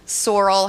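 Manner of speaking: "sorrel" as SOR-ul, stressed up front; natural-mouth pronunciation. Shown as a demonstration. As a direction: say "sorrel" with first-syllable stress and a swallowed ending. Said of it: "my mouth wants to say it".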